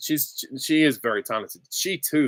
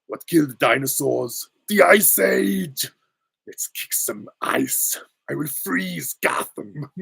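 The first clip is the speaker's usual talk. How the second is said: put-on slow, hammy Austrian-accented villain; over-the-top action-star supervillain; Arnold Schwarzenegger; 5 semitones higher, slower than usual. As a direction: stretch the puns out in a thick Austrian accent. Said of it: "I can more do Batman and Robin".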